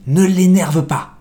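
nervous warning. Acted. Host warns about the emperor.

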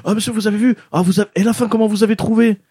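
high, eager fan asking about the ending. Retold or imitated. A fan pestering him about the finale, mock eager.

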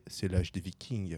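mock deadpan quote. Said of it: "capable de dire".

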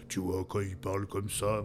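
imitating a deep sepulchral voice. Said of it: "sa voix sépulcrale".

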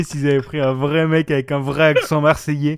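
half-shouted, loud and hammed-up; loud mock excitement. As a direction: blurt it out loud as a joke. Imagining Marseille accent, very loud. (crié fort, en rigolant)

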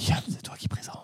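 real whispered aside; hushed, voiceless cue to a colleague. Real, not acted. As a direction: whisper a discreet prompt. Whispered prompt to the presenter.

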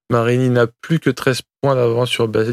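ordinary delivery

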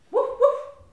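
loud high woof woof; dog bark. Acted. Barking dog greeting Tom at night.

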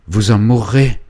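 loud, high anguished cry; cried out. Acted. Félicien crying out to Angélique in horror. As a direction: shout it in horror. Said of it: "cria-t-il".

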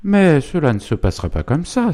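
indignant cry. A narrator's reading. Mother objects to the duel, crying out indignantly. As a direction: cry out as the outraged wife. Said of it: "s'écria Zélie".

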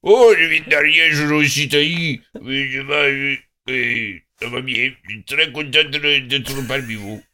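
put-on thick Ariège accent, duck fat in the throat. Ariège local greeting the company, thick mock Ariège accent. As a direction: talk as if duck fat coats your throat, Ariège style. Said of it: "je parle comme ça, avec le gras de canard dans la gorge".

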